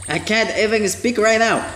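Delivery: ordinary talk, nothing marked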